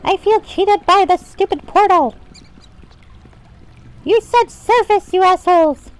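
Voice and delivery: high, loud indignant protest; outraged rant. Pretend. Player cheated by a portal to storage, high outraged rant. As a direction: complain at high pitch, bursting out on key words.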